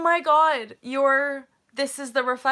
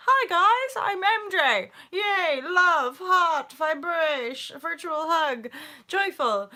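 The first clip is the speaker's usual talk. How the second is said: high, bouncy fake positivity; bubbly vlogger cheer; 7 semitones higher, 3 dB louder, slower than usual. Vlogger mocking her own cheery intro, high and bubbly. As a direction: sell the joy a bit too hard. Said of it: "hence why I can be like".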